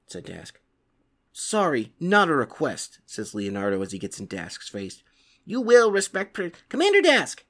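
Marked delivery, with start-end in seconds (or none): 5.5-7.4 high, louder demand; angry shout. Acted. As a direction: get in his face. Leonardo confronts Dask.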